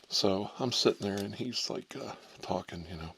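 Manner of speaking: ordinary talk, nothing marked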